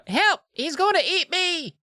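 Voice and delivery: mock shriek. Alicia begging for help from the monster, a high shriek. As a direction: shriek in panic. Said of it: "Alicia shri".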